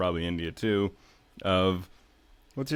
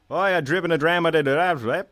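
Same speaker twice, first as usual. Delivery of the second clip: high, loud mock Glasgow accent; a hammed-up Glaswegian, shot out fast and high; Glasgow accent impression; 9 semitones higher, 6 dB louder than usual. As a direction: rattle it off like a Glasgow local, pitched up and loud. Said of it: "in the classic Glasgow terminologies".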